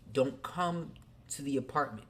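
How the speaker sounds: ordinary talk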